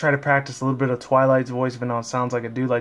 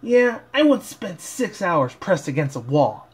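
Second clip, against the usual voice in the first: higher, slow put-on pony voice; Rainbow Dash voice; 4 semitones higher, slower than usual. Fan voicing Rainbow Dash recalling being squashed, higher and slow. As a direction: grumble it in a high cartoon filly voice. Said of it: "Dash said".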